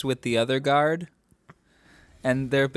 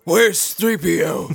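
loud slow higher yell; yelled quote; 6 semitones higher, 7 dB louder, slower than usual. Retold or imitated. Riffing on the quote with droids, loud slow higher yell. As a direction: drag it out like the movie shout. Star Wars variant yell.

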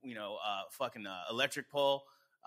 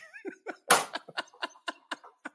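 long high-pitched giggle; real helpless laugh; laughter; 3 dB louder than usual. Real, not acted. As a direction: keep cracking up after the punchline. Laughing at own joke.

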